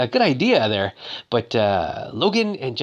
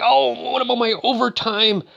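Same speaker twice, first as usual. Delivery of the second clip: high, upset whine about being cheated; mock-upset, pitched way up; 11 semitones higher than usual. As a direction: imitate an upset woman's high complaint. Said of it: "like, all upset. She's like".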